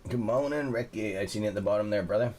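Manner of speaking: ordinary delivery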